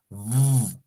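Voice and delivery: "V" as a long buzzing labiodental v; drawn-out V sound. Shown as a demonstration. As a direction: hold "V" as a voiced buzz, lip on teeth. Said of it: "La V suena así".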